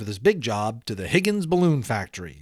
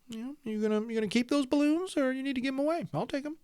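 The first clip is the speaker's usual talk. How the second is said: put-on high, pleading little kid asking for the balloons; squeaky child begging the workmen; eager kid voice; 13 semitones higher, 6 dB quieter, faster than usual. Teacher voicing a hopeful child to the class, high and pleading.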